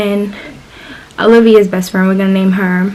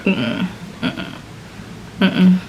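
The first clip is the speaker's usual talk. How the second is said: disapproving hum; 9 dB quieter, much slower than usual. Retold or imitated. Imitating a girl who refuses to like someone, disapproving hum.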